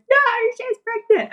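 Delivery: high-pitched grandma insisting; mock exasperated cry. Grandma insisting from other side.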